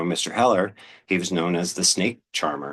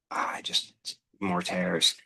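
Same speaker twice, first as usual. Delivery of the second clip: whisper; 6 dB quieter, much slower than usual. Real, not acted. Paper tearing as she holds it.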